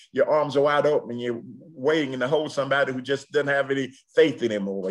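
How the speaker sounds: normal talking voice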